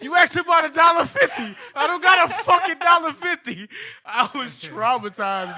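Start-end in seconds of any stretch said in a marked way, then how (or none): 0.0-3.7 loud, high furious rant; imitating an angry father shouting; dad yelling. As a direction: yell it like an exhausted dad who snapped. Dad yelling retold again.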